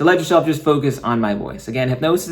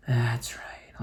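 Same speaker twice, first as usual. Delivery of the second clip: whisper; 10 dB quieter than usual. Acted. Whispered affirmation.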